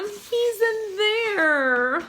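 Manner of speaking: put-on high, very slow forlorn kid; slow sad boy voice. A narrator's reading. Sam pointing at the hamsters, slow and forlorn. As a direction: drag it out sadly.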